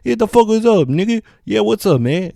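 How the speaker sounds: high, animated street-slang voice; mock street-guy voice. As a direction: throw it back loudly in exaggerated street slang.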